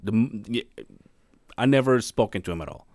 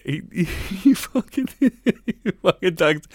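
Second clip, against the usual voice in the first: slowed, breaking into laughter mid-phrase; barely getting it out through giggles; laughing; 7 semitones higher, slower than usual. Real, not acted. Telling a friend about the boss, laughing and slowed.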